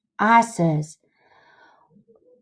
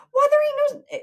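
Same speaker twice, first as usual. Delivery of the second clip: high-pitched disbelief; incredulous protest; 4 dB louder than usual. Acted. Huck hears Tom wants Jim's leg off, incredulous. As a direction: voice leaps up in shock.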